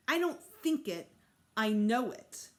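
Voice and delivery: loud, slow and firm; stubborn boy insisting he's right; defiant. A narrator's reading. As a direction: plant each word against her. Defiant reply to Mom.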